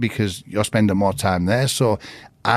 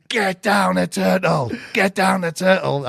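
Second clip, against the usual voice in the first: slow, pitched-up menacing order; retold slow command; 9 semitones higher, slower than usual. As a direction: drag out each word like a threat.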